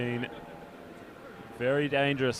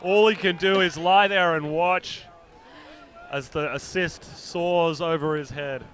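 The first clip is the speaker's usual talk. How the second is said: higher and louder, slow play-by-play; excited; 5 semitones higher, 5 dB louder, slower than usual. Real, not acted. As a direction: savour the big throw as it lands. Calling the huck score.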